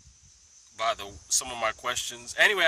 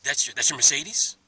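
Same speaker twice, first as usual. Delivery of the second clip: loud; 2 semitones higher, 10 dB louder than usual. Retold or imitated. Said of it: "He said".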